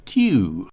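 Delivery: over-pronounced "tew" for the word to; enormous "tew". Shown as a demonstration. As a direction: stretch "tew" into a big "tyoo". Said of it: "becomes the enormous word".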